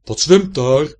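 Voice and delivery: put-on loud booming call of a half-blind old fish; old pike calling. A narrator's reading. Old pike shouting at something swimming past, loud call. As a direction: bellow it out into the murky water. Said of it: "en hij roept".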